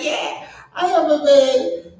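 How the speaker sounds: hammed-up sassy lady; mock sassy reply. Quoting the lady's post to her viewers, mock sassy and loud. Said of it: "She's like".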